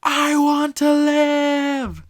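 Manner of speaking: high, loud, long-drawn imitated scream; crying scream. Robin screaming out to Luffy, desperate drawn-out scream. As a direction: imitate a desperate cry at the top of the voice. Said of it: "she's crying her eyes out".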